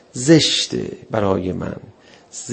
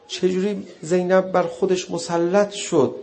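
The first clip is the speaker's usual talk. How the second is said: normal talking voice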